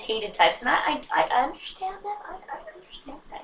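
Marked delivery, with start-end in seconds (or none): 1.3-3.4 sheepish admission. Real, not acted. Sheepish admission, high and soft.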